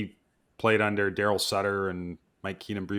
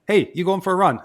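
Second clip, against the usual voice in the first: higher-pitched mock Australian lilt; lightly put-on Australian accent, pitched up; imitated Australian accent; 8 semitones higher, 4 dB louder than usual. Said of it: "in an Australian accent".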